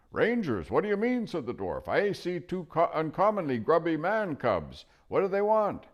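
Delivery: put-on high, loud cranky old dwarf; deaf old dwarf. Dwarf cupping his ear at the owl, loud and cranky. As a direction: bark it like a deaf old man.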